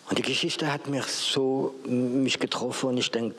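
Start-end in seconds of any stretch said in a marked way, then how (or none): none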